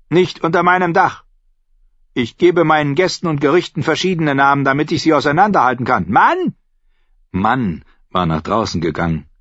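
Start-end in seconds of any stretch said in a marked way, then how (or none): 0.1-6.5 high, stern landlady voice; bossy innkeeper. Acted. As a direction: lay down house rules, then yell for husband.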